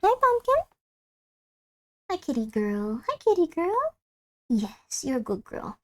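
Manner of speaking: high then hushed pet cooing; soft cooing pet voice. Real, not acted. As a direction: greet the cat sweetly. Greeting cat.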